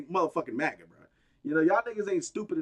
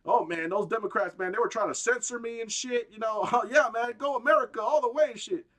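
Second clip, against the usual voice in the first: mocking Zuckerberg; 6 semitones higher than usual. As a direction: ham up a fake-patriot tech boss. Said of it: "now he's like".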